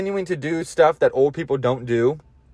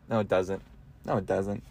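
low mock inner voice; 8 semitones lower, 11 dB quieter than usual. Answering himself in a low voice.

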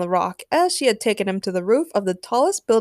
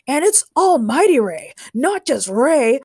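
higher, louder wrestler's swagger; boastful correction; 5 semitones higher, 6 dB louder than usual. A narrator's reading. Wrestler corrects his coach on his ring name, boastful. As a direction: proclaim it with self-importance.

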